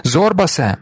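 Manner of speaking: yelling after a friend in danger. A narrator's reading. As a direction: shout into the collapsing mine. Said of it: "заволав я".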